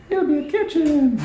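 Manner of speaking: sung out high and loud; mock grand reveal. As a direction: announce it like a big surprise.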